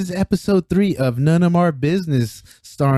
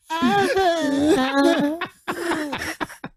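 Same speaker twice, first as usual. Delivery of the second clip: very high squeaky mock yawn; imitated yawn; 4 dB quieter than usual. Friend mimicking how Keenan yawns, high squeak. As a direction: yawn in a tiny falsetto squeal. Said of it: "when Keenan yawns, it's just like".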